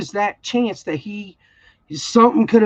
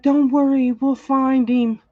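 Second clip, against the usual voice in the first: mock reassurance; 5 semitones higher than usual. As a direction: imitate staff soothing a worried parent. Mimics school staff reassuring her.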